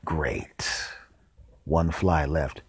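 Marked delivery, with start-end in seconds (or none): 0.1-0.7 hushed deadpan; whispered aside. Acted. After drowning the fly, whispered satisfaction. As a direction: breathe it under your breath.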